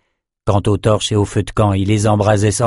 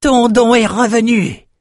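very high, gleeful cry; jubilant; 14 semitones higher, 4 dB louder than usual. A narrator's reading. André sees Nathan's gift return, high jubilant squeal. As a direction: crow with delight at a triumph. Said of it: "jubila André".

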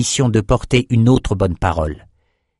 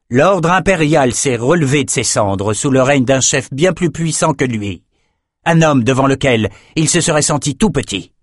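higher, cool sorceress voice; a proud sorceress, pitched up; Nicci character voice; 4 semitones higher than usual. Acted. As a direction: speak down to the messenger, icy and sure. Nicci answers the messenger.

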